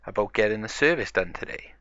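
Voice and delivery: ordinary delivery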